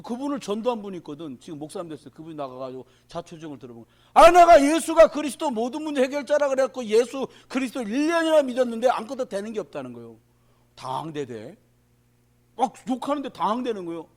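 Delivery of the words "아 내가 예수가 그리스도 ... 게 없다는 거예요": loud, higher retold grievance; mock angry complaint. Pastor retelling a deacon's complaint at church, loudly ranting. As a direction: rant like a bitter churchgoer.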